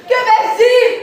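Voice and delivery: high, loud declaimed question; theatrical outcry. Acted. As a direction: cry the question out to the hall. Repeats the rhetorical outcry.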